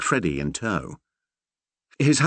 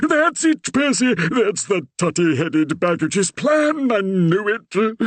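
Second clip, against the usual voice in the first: much higher, louder panicked vicar; the old man's alarm running away with him; rising panic; 13 semitones higher, 4 dB louder, slower than usual. A narrator's reading. The Reverend panicking to Percy about his daughter, rising alarm.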